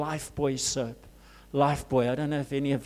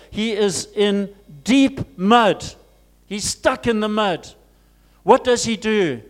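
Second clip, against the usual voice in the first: loud, raised preaching voice; fervent preaching; 6 semitones higher, 7 dB louder than usual. Acted. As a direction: preach it out with full force. Preaching at full force.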